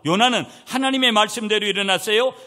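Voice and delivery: loud, high scripture proclamation; forceful preaching. Real, not acted. Preacher proclaiming Jonah obeying God, forceful preaching. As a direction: declare the verse to the congregation.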